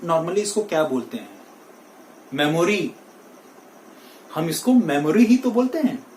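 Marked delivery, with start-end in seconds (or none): 2.3-2.9 मेमोरी in three syllables, me-mo-ree; wrong pronunciation. Shown as a demonstration. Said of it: "that is wrong". 4.7-5.2 मेमोरी in three syllables, me-mo-ree; wrong pronunciation. Shown as a demonstration. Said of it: "that is wrong".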